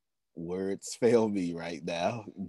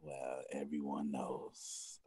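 slow, soft whisper; 5 semitones lower, 12 dB quieter, slower than usual. Real, not acted. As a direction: let it fall to a whisper, slowly. Emotional husband, words fail.

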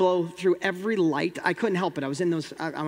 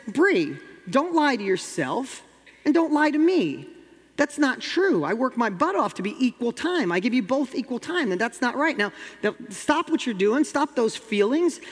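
stern scolding; 5 semitones higher than usual. Retold or imitated. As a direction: scold her firmly, shutting down her feelings. Father scolding crying daughter.

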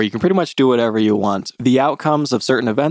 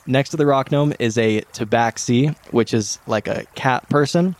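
normal talking voice

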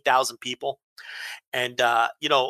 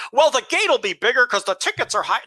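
higher-pitched mimicry of a clueless critic; mocking objection; 7 semitones higher than usual. Host mimicking fans who argue ticket prices, mocking. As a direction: imitate a naive know-it-all, pitched up.

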